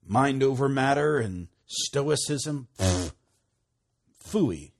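loud, drawn-out scorn ending in a blown raspberry; emphatic dismissal. Real, not acted. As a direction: scoff at it emphatically. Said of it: "Can't get any more emphatic than that".

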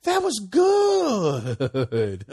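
high, loud, drawn-out joyful cry; overjoyed cry. Retold or imitated. Two friends celebrating the clean test results, overjoyed cry. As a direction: let it ring out slowly in joy. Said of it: "We were like".

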